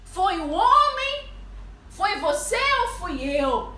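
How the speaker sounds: loud, slow, high rhetorical questions; preacher acting out a divine rebuke; voicing God's challenge. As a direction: demand an answer, stretching each question. Voices God questioning her.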